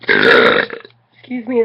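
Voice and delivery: a hammed-up indignant baritone; mock deep voice. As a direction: drop into a pompous mock-formal tone. Mock-offended interruption.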